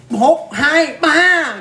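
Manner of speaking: high, loud count on each whack; acted counting out. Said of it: "Bà vỗ vậy nè".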